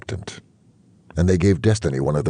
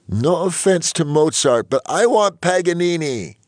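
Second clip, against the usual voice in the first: high-pitched wifely plea; wife's insistent protest; 4 dB louder than usual. A narrator's reading. Sedated wife objects, wanting Paganini.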